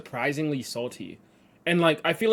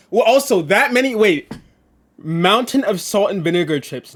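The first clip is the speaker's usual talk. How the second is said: excited; 5 semitones higher, 8 dB louder than usual. Real, not acted. Excited rant after shock.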